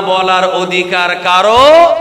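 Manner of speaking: loud drawn-out call, swelling at the end; rousing call. Real, not acted. Preacher asks congregation who has the right, rousing drawn-out call. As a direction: stretch it out and push the crowd to answer. Prompting congregation to answer loudly.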